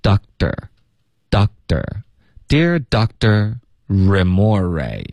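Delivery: "Doctor" with the t softened to a d; slow demo, "Doctor" sounded like dok-der; voiced t. Shown as a demonstration. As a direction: pause on the k, then a d in "Doctor". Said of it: "我们会浊化成一个的的音".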